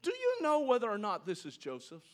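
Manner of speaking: light, high, offhand "Hey, Dad" asked slowly; casual sons' question. Acted. Brothers casually handing the bloody coat to their father. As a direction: ask it innocently, just planting a hint. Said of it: "Notice his son's words".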